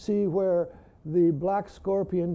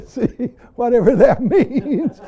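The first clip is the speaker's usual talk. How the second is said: laughing; 3 semitones higher, 9 dB louder than usual. Real, not acted. Joking about retirement, laughing.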